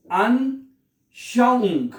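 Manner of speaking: "अनशाउंग" slowly as an, shau-ung; high, slow syllable demo of "अनशाउंग"; broken into parts. Shown as a demonstration. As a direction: pause after "an" in "अनशाउंग", then draw out shau-ung. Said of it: "पहले लफ्ज को तोड़ लें".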